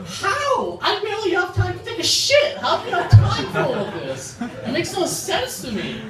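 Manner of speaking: high-pitched, incredulous rant; baffled disbelief. Acted. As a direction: squeal it in comic bewilderment. Baffled rant at women's routines.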